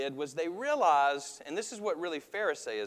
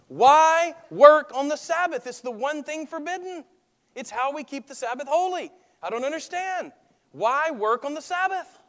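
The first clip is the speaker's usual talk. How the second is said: indignant synagogue leader; 11 semitones higher, 8 dB louder than usual. Retold or imitated. Synagogue leader complaining to the crowd, whining with indignation. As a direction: play the fussy offended official. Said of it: "this guy is just saying the same thing over and over again to the crowd".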